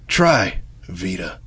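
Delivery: low, hushed seductive commercial read; sultry ad voice. Acted. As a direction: purr the pitch like a late-night ad. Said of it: "Give me a beat".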